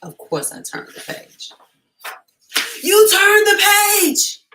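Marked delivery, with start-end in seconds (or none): 2.8-4.5 high, very loud outraged cry; shocked Grover yell. Acted. Grover yelling at the reader for turning the page, high and loud. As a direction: shriek it like the page-turn betrayed you. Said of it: "I wish I could do his voice".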